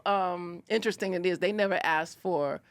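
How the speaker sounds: ordinary talk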